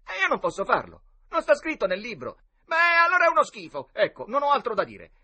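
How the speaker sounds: put-on high, loud childish protest; refuse, then object in a huff; indignant squabble. A narrator's reading. Boys argue over the rules. (battibecco indignato da ragazzini)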